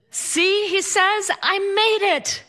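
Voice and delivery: high, slow smug boast; husband's smug voice. Retold or imitated. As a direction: gloat like you arrived just in time. Husband's smug arrival quote.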